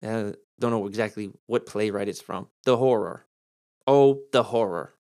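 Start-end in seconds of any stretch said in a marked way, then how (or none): 2.6-4.9 a touch of theatrical dread, slower on the second phrase; understated stage despair; mild dramatic lament. Shown as a demonstration. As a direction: lightly ham up the line. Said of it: "Or what about the old".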